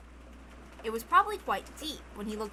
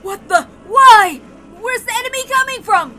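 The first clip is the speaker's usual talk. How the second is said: higher, louder startled yells; alarmed shouting; 10 semitones higher, 19 dB louder than usual. Acted. Kraus crying out at the unseen enemy, alarmed. Sudden attack confusion.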